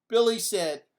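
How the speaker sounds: plain reading voice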